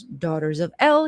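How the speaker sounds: ordinary delivery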